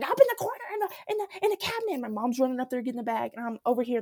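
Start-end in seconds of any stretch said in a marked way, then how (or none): 0.0-1.9 high, frantic mock yell; high-pitched flustered shouting impression; nurse yelling. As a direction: imitate a nurse yelling urgent directions. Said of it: "yelling at my mom".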